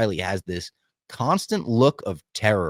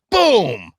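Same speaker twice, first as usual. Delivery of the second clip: loud high "boom" like a blaster shot; mock gunshot boom; 11 semitones higher, 8 dB louder than usual. Podcaster acting out the blaster shot with a loud boom. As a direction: punch out the shot sound.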